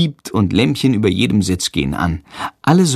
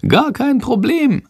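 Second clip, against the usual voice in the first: high, drawn-out bat voice; cocky little bat. A narrator's reading. Bat answers the boy's praise, high and cocky. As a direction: pipe it up high and slow.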